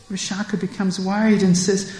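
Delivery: usual speaking voice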